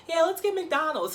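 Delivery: high, eager agreement; mock eager yes. Ashley happily agreeing to McDonald's, high and eager. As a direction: jump at the idea.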